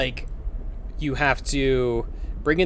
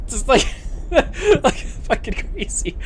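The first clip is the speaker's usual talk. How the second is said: laughing disbelief; 7 semitones higher, slower than usual. Real, not acted. Laughing describing raging daughter.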